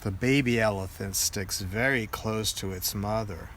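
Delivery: ordinary delivery, nothing marked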